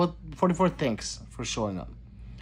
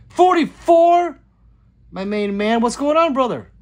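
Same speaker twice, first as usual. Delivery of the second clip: shouted greeting; 11 semitones higher, 9 dB louder than usual. Real, not acted. Streamer hails his main man joining, shouted high and loud. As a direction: holler a big welcome to a buddy. Calling out to arriving friend.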